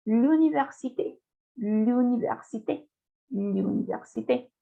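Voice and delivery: "L'université" with a rounded French U, close to iu; "L'université" demonstrated with French U, syllable by syllable. Shown as a demonstration. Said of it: "se pronuncia como la U francesa".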